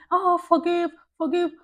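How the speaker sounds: high, loud, put-on voice for people urging forgive; high mocking imitation of advice-givers; mock preachy advice. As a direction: parody the people who say forgive. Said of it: "people are quick to say".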